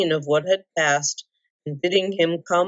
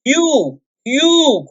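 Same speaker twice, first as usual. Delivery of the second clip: high, loud accusing cry; furious dwarf crying out; 9 semitones higher, 5 dB louder than usual. A narrator's reading. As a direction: hurl it at him. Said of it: "cried Thorin".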